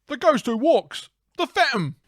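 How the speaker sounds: high, loud proclamation; dramatic character voice. Acted. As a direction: announce the legend with overblown awe. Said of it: "just 'cause of the way you said".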